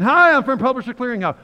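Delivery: very loud, high, hammed-up greeting; mock prize announcer. Fake Publishers Clearinghouse caller at the door, mock excited announcing. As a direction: burst out like you bring a big prize.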